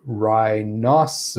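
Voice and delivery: "rhinoceros" stretched syllable by syllable; really slow enunciation. Shown as a demonstration. Teacher illustrating word speed, slowly enunciated "rhinoceros". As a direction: draw out each syllable of "rhinoceros" separately. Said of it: "enunciate each syllable really slowly".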